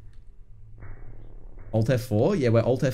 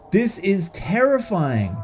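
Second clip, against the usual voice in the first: ordinary talk, nothing marked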